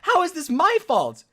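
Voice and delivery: loud, high outraged rant; acted-out furious protest, voice climbing; heated. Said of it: "So I'm heated. I'm mad".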